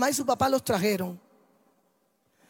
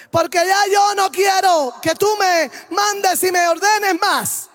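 rising to a louder, higher defiant shout; defiant son; 5 semitones higher, 4 dB louder than usual. Retold or imitated. Son telling his mother he won't obey, building to a shout. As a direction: throw it back at mom, building anger.

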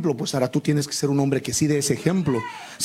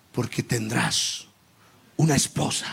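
slow, low, solemn mother's voice; low, slow prophetic mother; 7 semitones lower than usual. Retold or imitated.

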